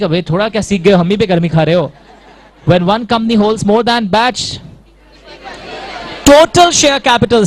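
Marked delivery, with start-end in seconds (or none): 2.7-7.4 slow, loud stylish recital. Shown as a demonstration. Teacher showing off the definition to the class, slow and loud. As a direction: show off the definition with style. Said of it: "style देख रहे हो क्या?".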